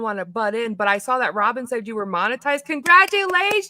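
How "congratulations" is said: excited congrats. Real, not acted. Cheering friend on monetization.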